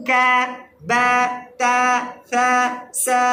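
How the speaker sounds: "ক্যা, ব্যা, ত্যা, ফ্যা, স্যা" with light front vowels; light, unrounded vowels, one at a time; thin letters. Shown as a demonstration. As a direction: recite each syllable thin and separate. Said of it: "এগুলো পাতলা হয়".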